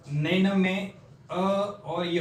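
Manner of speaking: drawn out syllable by syllable, "नैनम" stretched; slow careful pronunciation. Shown as a demonstration. Teacher to students, showing how नैनम sounds, slowly. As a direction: spell out "नैनम में अ और य" slowly so every sound is heard. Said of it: "थोड़े उच्चारण पर ध्यान दें".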